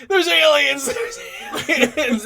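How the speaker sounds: high, loud manic yell, laughing; mock raving shout. Grandpa on acid ranting about aliens, mock shouting. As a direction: rant like a wild-eyed old man.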